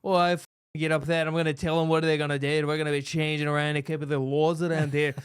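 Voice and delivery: put-on brash, loud Irish accent, rattled off; cocky fighter's speech in a thick Irish accent; Conor McGregor. Imagining a fighter as president, brash Irish accent. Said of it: "dude, it's Conor McGregor".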